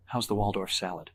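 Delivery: ordinary delivery, nothing marked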